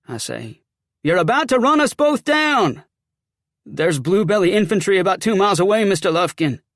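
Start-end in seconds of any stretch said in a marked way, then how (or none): 1.0-2.8 loud, high-pitched holler; shouted warning. Acted. Calls out to oncoming rider. 3.7-6.6 loud, higher-pitched soldier making a report; agitated Southern soldier; rattled sergeant. Acted.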